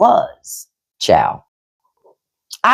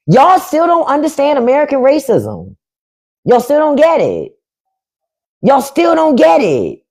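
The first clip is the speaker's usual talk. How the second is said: scolding, rising to a shout; 6 semitones higher than usual. Real, not acted. Streamer scolding the viewers, rising to a shout. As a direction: build from firm to a shout.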